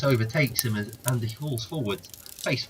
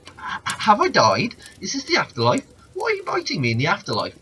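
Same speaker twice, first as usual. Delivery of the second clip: higher, stammering, disbelieving man; bewildered man; 8 semitones higher, 5 dB louder than usual. Acted. Bitten man asking the snake if he died, bewildered. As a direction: sound lost and confused, then rush the last question.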